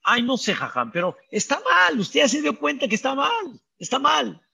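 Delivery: high, whiny protest; lightly voiced complaining man; defensive husband. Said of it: "Tú, Shimón".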